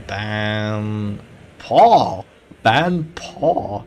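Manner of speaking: loud, drawn-out announcer shout; excited call. Real, not acted. Caster announcing a surprise ban, excited shouting.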